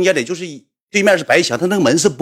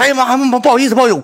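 flustered apology; 11 semitones higher, 4 dB louder, faster than usual. Retold or imitated. Caught walking in, apologising.

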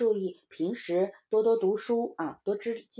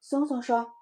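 plain reading voice

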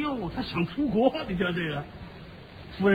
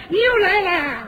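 mock bird greeting; 9 semitones higher, 4 dB louder, slower than usual. Bird greets returning visitor.

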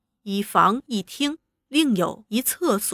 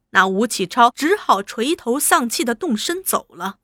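usual speaking voice

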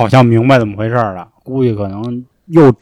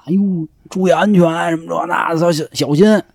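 mock-caring call, pitched up; fake concern; 5 semitones higher, 3 dB quieter than usual. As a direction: pretend to care, overdoing the warning. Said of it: "我们就假热情吧". (假装热情地喊)

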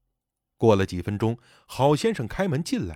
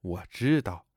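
low, resigned sigh of words; quietly resigned, lower; 8 semitones lower, 4 dB quieter than usual. A narrator's reading. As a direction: say it on a weary sigh. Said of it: "小杨叹了一口气".

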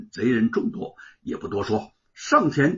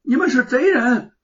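high, loud accusing shout; loud yell; 11 semitones higher, 8 dB louder than usual. A narrator's reading. Boy yelling at the sword-wielding thief, loud accusation. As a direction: yell it at the attacker. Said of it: "放声大喊".

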